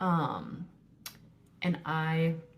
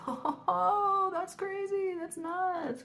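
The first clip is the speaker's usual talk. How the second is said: much higher, lightly voiced giggly friend; mock laughing congrats; 13 semitones higher, much slower than usual. Friends reacting to her pregnancy news, a high mock-giggly congrats. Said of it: "like, laughing".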